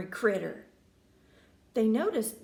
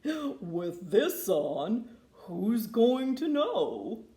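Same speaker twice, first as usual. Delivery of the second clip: sly bullfrog; much slower than usual. A narrator's reading. Bullfrog reassuring the grandma, slow sly voice. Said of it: "The bullfrog slapped a big sombrero".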